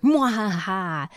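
mad scientist. Pretend. Evil laugh after mock title.

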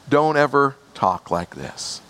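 ordinary talk, nothing marked

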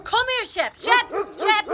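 high, loud call across a field; loud high call to the dog. Acted. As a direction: shout for the dog to come. Calling the dog.

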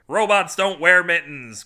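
loud, high, slow exclamation; emphatic, drawn-out shout of refusal; heartless cry. A narrator's reading. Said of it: "cried the heartless mitten manufacturer".

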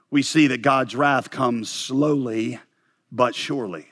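drawn out slowly, pausing before "but surely"; slow, deliberate point. Real, not acted. Pastor announces point six on God's wrath, slow and deliberate. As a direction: stretch "slowly", pause, then land "but surely".

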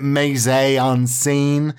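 MISE EN SCENE said as "may-zay on seen"; wrong French pronunciation. Shown as a demonstration. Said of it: "How do we pronounce it?".

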